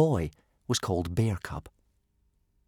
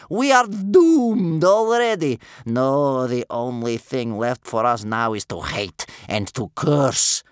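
cursing grandmother; 5 semitones higher, 7 dB louder, slower than usual. A narrator's reading. Grandmother tells grandson all is lost, cursing. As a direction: proclaim doom with bitter fury. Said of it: "chained to a cursing grandmother".

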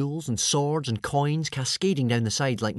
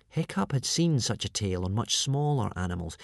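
usual speaking voice

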